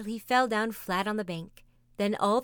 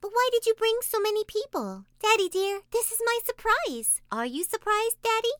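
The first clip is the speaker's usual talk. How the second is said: put-on Taffy, excited child; 14 semitones higher, 5 dB louder than usual. A narrator's reading.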